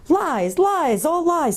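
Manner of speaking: high-pitched outraged interruption; outraged denial. A narrator's reading. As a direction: cut in high, hammering each repeat. Badger interrupts angrily.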